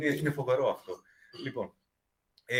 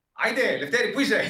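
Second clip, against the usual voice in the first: high, loud call to a husband; wife calling out; 8 semitones higher, 6 dB louder than usual. Retold or imitated. Wife summoning her husband to bed, high impatient shout. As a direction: call out loudly, pitched well up. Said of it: "φόραζε".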